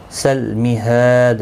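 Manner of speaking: slow سل مہاد with a still لام; tajweed demo. Shown as a demonstration. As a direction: keep the لام of سل مہاد unmoving. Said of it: "لام ساکن کو ہلانا نہیں".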